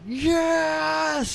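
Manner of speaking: high shouted yes; excited scream. Retold or imitated. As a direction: jump up yelling yes. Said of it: "I stood up screaming".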